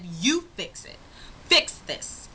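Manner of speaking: acted-out not-having-it command; fed-up demand. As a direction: hand it over firmly, then hit the last order harder. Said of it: "I was not having it".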